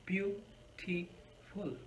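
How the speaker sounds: "beautiful" slowly split as beau-ti-ful; syllable by syllable. Shown as a demonstration. Teacher asks how many syllables, split apart. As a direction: break "beautiful" into three slow beats. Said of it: "Of course, three syllables".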